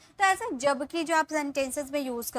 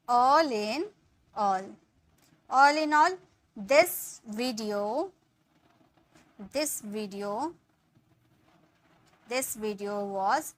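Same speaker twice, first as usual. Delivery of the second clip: slow dictation; 2 semitones lower, much slower than usual. Shown as a demonstration. Teacher slowly dictating the example sentence. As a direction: lay it out gently for learners. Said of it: "तो हम बोलेंगे".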